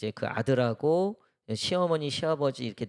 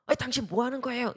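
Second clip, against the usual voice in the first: mock angry retort; 9 semitones higher, faster than usual. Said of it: "며느리가 그 남편한테 화를 내는 거죠".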